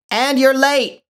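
calling out. Acted. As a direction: yell it down the hall at her. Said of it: "Linda called from where she stood".

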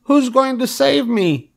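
high, drawn-out piglet wail; pleading cry. A narrator's reading. Wilbur asks who will save him, pleading cry. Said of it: "cried Wilbur".

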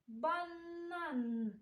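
"Банан" with both Ns held long; sound-position demo. Shown as a demonstration. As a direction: stretch each N of "Банан". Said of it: "де знаходиться звук Н".

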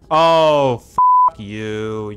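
real angry yelp; frustrated outburst. Real, not acted. Game mocking him, sudden outburst.

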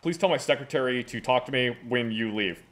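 low, gruff boss voice; goofy muffled sock puppet. Acted. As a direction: play a pompous low-voiced official. Plays the official in the skit.

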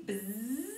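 mock bee buzz. Shown as a demonstration. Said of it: "I can buzz like a bee".

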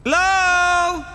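shouted hello echoing in a cave. Real, not acted. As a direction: call out loud into a cave. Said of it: "the echo in this cave is just".

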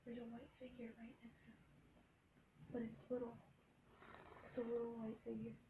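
hushed creepy whisper. Real, not acted. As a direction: whisper so the ghost won't hear. Ghost hunting, hushed voice.

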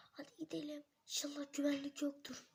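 hushed whisper; sneaky whisper. Acted. Robbers sneaking into the dark bank, whispering.

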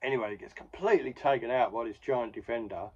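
ordinary delivery

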